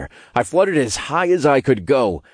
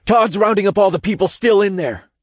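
high-pitched, raised urgent voice; urgent explaining; 10 semitones higher, 3 dB louder, faster than usual. A narrator's reading. Hero holding up the tower with cultists, urgent. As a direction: explain it urgently over the chaos. Shouting explanation under strain.

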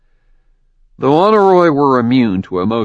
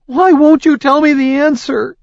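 desperate shout; 6 dB louder than usual. Acted. Frustrated frightened heroine.

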